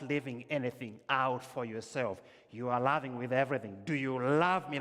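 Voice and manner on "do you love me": "Do you love me?" much louder and higher, the agape kind of love; all-out love. Shown as a demonstration. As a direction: throw everything into "Do you love me?". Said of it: "Like that, use the word agape".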